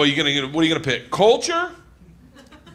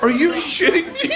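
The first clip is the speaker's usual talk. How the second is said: mock disbelief; 10 semitones higher than usual. Mock shock at Anne Frank story.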